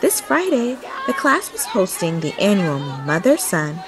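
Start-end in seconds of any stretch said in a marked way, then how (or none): none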